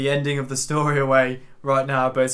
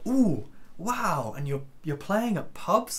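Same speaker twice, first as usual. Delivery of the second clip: higher-pitched cooing wonder, imitating an impressed adult; mock amazement; 4 semitones higher, slower than usual. Grown-ups hearing a child plays pubs, exaggeratedly impressed. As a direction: act wowed for a little kid's benefit.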